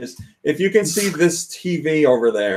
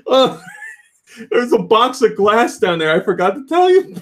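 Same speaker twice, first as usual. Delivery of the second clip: higher-pitched, hesitant confession; lightly hammed "whoops" voice; imitated sheepish dad; 9 semitones higher than usual. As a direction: admit it like a forgetful dad. Dad's sheepish admission, retold.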